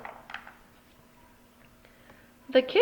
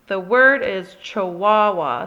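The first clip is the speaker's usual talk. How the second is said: louder, slow, frazzled correction; exasperated mother setting the record straight; 8 dB louder than usual. Acted. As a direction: correct her sternly, at the end of your rope. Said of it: "said Mama, frazzled".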